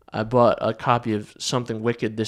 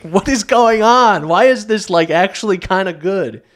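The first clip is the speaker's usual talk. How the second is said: amazed disbelief; 8 semitones higher, 8 dB louder than usual. Retold or imitated. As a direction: blurt it out in disbelief. Retold own amazed reaction.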